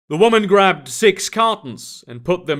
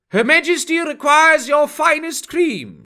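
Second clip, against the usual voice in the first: much higher, grand and commanding; haughty demand; 12 semitones higher, 3 dB louder than usual. A narrator's reading. Fairy envoy ordering cream from the cashier, high haughty demand. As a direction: demand it like a royal herald. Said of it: "she demanded".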